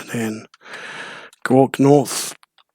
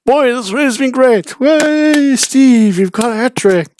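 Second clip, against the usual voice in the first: much higher, hammed-up old-timer voice; silly higher voice for Steve's catchphrase; squeaky Steve voice; 11 semitones higher, 5 dB louder, slower than usual. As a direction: squeak it out like a goofy old man.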